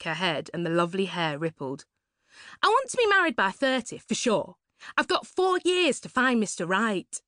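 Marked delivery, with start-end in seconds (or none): 2.6-7.2 put-on high, breathy-bright posh young woman; a high, gushing party girl; Suzanne's gushing voice. To Laurie at the party, a high gushing voice.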